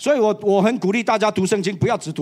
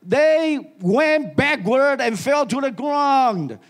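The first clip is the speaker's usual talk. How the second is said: slow dramatic telling; 5 semitones higher, much slower than usual. Real, not acted. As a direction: stretch each word out slowly. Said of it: "slow dramatic retelling". (慢慢地戏剧性地讲)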